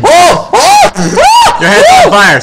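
high, loud startled yelling; excited shout. Real, not acted. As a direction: yell it out in sudden alarm. Friend's head on fire effect.

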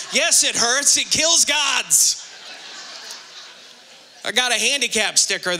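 ordinary talk, nothing marked

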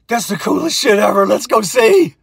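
high, loud gleeful whoop; thrilled. Retold or imitated. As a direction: get giddy about the burning truck. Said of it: "He was like".

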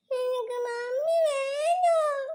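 put-on high, slow, whining little girl; whiny crying kid. Child wailing to teacher that she wants mummy. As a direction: wail it like a homesick child. Child role in class skit.

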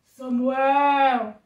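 loud, drawn-out booming call; =big mighty calling voice; voice of God. Acted. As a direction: summon grandly, holding the last syllable. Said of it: "a voz chamou mais uma vez".

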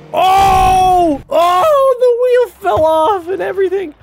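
high, loud delighted yelling; excited shouts. Real, not acted. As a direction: shout it out with glee at the crash. Huge crash in the game.

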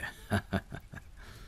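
quick airy chuckle under the breath; a short amused laugh, barely voiced; soft breathy laugh. Real, not acted. Amused at her wearing his outfit, airy chuckle.

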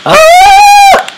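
sharp high-pitched scream; startled shriek. Real, not acted. Gamer caught off guard by an enemy, sharp high shriek. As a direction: shriek at a sudden threat.

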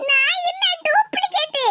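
high-pitched feeble denial; whiny excuse. Acted. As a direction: plead it in a high daughterly whine. Daughter's feeble excuse about the video.

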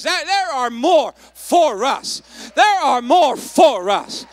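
slow, loud preacher's declaration; rallying shout. Real, not acted. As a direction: shout it out for the congregation. Repeats verse for congregation to shout.

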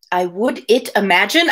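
questioning "I would it imagine?" with a rising end; stumbling over "it" with a doubtful rise; puzzled reread. Real, not acted. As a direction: reread the odd words as a baffled question. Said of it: "I have never noticed that before".

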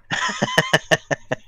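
high, quiet chuckling; laugh. Real, not acted. Streamer amused by friend's decibel setting, laughing. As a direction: laugh in short, high pulses.

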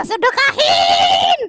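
high, very slow bellowed command; a stretched-out holy command; slow drawn shout. Acted. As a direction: draw it out like a preacher's demand. Repeated charity command.

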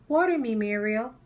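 a hint of a small eager boy; Herb asking. Herb asks Muriel to water him, light high voice. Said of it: "he said".

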